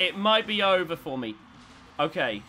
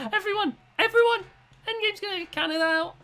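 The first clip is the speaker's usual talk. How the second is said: much higher, urgent call to the viewers; excited calling out; 13 semitones higher, faster than usual. Real, not acted. Player alerting viewers the rival is stuck, excited call. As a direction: grab everyone's attention, thrilled.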